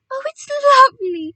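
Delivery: high, loud cry of delight. A narrator's reading. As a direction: exclaim it in rapture, pitched right up. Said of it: "cried Missus Gregg".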